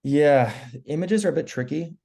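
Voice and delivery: rough, failing hoarse voice. Real, not acted. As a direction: voice giving out, hoarse. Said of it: "Sorry, my voice is going".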